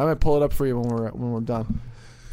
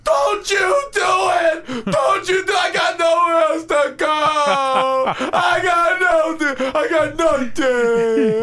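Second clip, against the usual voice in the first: high, loud, slow anguished yell; desperate shouting plea; 13 semitones higher, 4 dB louder, slower than usual. Retold or imitated. Host replaying the cadet's tearful breakdown as a loud, slow shout. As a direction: beg at the top of your lungs, voice cracking. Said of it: "He goes".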